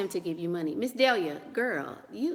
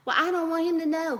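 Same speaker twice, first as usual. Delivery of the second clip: higher, louder put-on voice of a nervous girlfriend; mock worried girlfriend; 8 semitones higher, 3 dB louder than usual. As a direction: squeak it out like the timid girlfriend.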